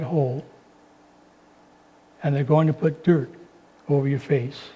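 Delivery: low, soft and solemn, long pauses between phrases; straight and personal. Real, not acted. Preacher telling listeners personally they will die, straight and hushed. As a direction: drop to a hushed, candid appeal. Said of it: "I want to say something personal".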